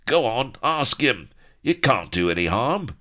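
high, loud heckling; pirate heckle. A narrator's reading. Pirates urging Bilbo on, high and loud. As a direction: egg him on loudly.

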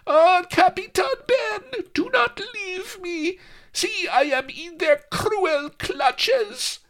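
very high, slow, tremulous cry; tremulous plea. A narrator's reading. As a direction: plead high and crying for rescue. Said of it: "a tremulous scream".

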